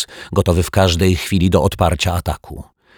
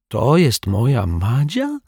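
put-on wondering girl; 6 semitones higher, slower than usual. A narrator's reading.

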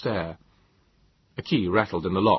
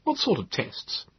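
stuffy; 10 semitones higher than usual. A narrator's reading. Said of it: "he said stuffily".

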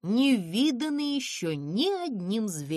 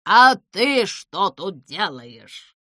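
slow, loud scolding; angry; 11 dB louder, slower than usual. A narrator's reading. The owl finds Pimpochka in her car, angry. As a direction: snap at the intruder. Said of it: "рассердилась сова".

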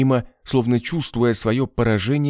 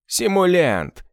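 фыркнул; 8 semitones higher, slower than usual. A narrator's reading. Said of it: "Генрима фыркнул".

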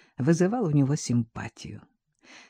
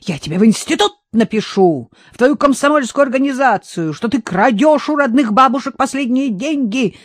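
loud, higher angry call; shouting after him; 6 semitones higher, 5 dB louder than usual. A narrator's reading. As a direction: yell it down the stairs. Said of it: "крикнула ему вдогонку".

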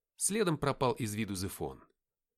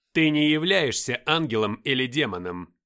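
usual speaking voice

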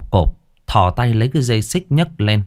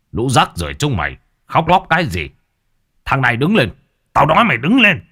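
angry yell; 3 semitones higher, faster than usual. A narrator's reading. Tôn Thái ordering the boy to stand, rising anger. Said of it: "Tôn Thái bực tức".

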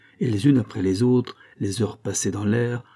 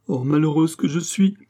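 higher-pitched acted lament; anguished outcry, narrated drama; wretched cry; 6 semitones higher, 4 dB louder than usual. A narrator's reading. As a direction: cry out in misery. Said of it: "cria Catherine".

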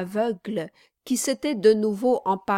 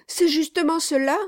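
quick, high eager cry; excited exclamation; 8 semitones higher, 4 dB louder, faster than usual. A narrator's reading. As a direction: blurt it out eagerly. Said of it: "s'écria le vieillard".

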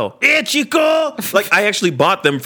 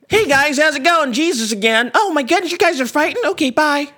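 high, breezy passing greeting; high mock Jesus; 8 semitones higher than usual. Jesus wanders in on the fight, high and chirpy. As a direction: pop in cheerfully and leave.

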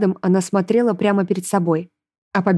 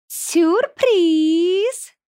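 high, drawn-out villainous crow; mocking, high-pitched demon trill; gleeful demoness; 11 semitones higher than usual. A narrator's reading. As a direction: sing it out as a gloating demoness. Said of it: "расхохоталась демоница".

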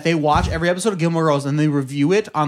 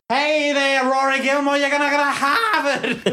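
high-pitched mock Boston accent; hammed-up Boston accent, pitched way up; 7 semitones higher, slower than usual. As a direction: call it out high, Harvard as "Hahvid". Mock Boston voice greeting Rory.

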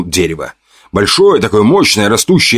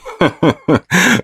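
hearty laugh; 10 semitones higher than usual. A narrator's reading. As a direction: laugh out at her threat. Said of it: "Расхохотался я".

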